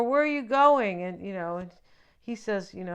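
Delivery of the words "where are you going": higher, louder imitated call; retold cry to someone walking off; mock crying out. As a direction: call after the one who is leaving, play-acted. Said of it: "she's crying out".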